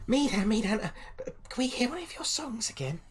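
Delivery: put-on high, breathless child voices begging; tiny kids whispering their plea; eager little kids. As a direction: beg like a small excited child.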